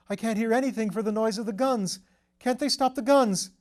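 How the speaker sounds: high, loud desperate panic; hysterical, haunted by the guns; frantic terror. Acted. Lord relives the mining under fire, high and frantic. Shell-shock hallucination, frantic.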